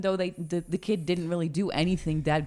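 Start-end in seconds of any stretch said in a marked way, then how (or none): none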